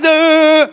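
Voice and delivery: raungan tangis yang keras dan tinggi; tangisan tiruan. Retold or imitated. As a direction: menangis meraung dibuat-buat. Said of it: "lu baru nangis". (pura-pura meraung menangis)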